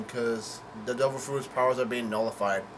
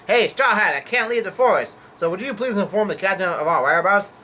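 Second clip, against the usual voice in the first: higher, louder acted call; 5 semitones higher, 5 dB louder than usual. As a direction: hail someone in a raised character voice. Polar bear calls to Straw Hat.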